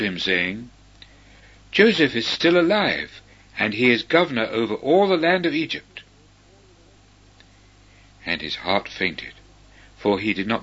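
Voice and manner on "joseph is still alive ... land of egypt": excited announcement. A narrator's reading. Brothers bring joyful news.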